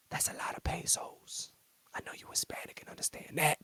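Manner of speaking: sly hushed joke; teasing whisper. Retold or imitated. Agent joking with Hernandez, sly teasing whisper. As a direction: whisper it like a cheeky aside.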